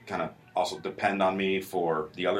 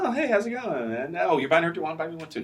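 playfully chummy, pitched up; mock-friendly greeting; 8 semitones higher than usual. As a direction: pretend casual buddy, high-pitched.

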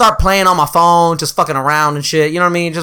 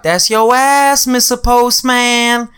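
slow, drawn-out higher menace; mom's slow threat; 5 semitones higher, slower than usual. Retold or imitated. Mom glaring at her son after he tips the cart, slow threatening drawl. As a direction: let the threat hang, word by word. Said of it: "She's looking at him like".

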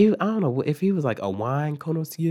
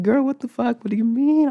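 high, loud acted-out protest; indignant comeback; 10 semitones higher, 4 dB louder than usual. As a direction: fire back in high-pitched disbelief. Acted quarrel, snapping back at friend.

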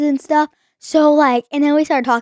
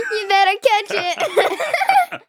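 laughing; 7 semitones higher, much slower than usual. Acted. Repeating the prank joke.

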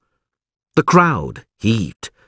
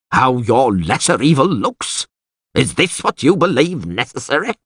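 raised pitch, appalled questioning; accusing; 5 semitones higher than usual. A narrator's reading. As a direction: demand an answer from him. Alderman accuses Witcher over bodies.

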